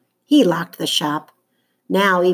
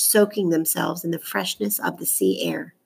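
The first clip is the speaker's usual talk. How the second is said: plain reading voice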